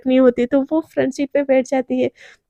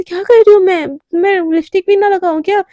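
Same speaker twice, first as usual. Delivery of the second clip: indignant retort; 5 semitones higher, 5 dB louder than usual. Acted. Sister snaps back at brother.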